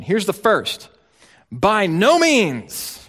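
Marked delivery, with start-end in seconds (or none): 1.5-3.0 slow, weighty By no means; emphatic protest. Retold or imitated. Paul rejecting the question, slow and emphatic. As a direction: lean hard on each word. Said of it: "Protest is his first response".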